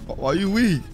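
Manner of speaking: normal talking voice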